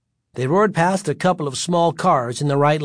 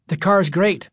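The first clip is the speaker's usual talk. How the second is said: mildly enthusiastic, pitched up; forced enthusiasm; 5 semitones higher than usual. A narrator's reading. Worried son to dad, trying to sound enthusiastic. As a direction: try to sound keen. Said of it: "trying to sound enthusiastic".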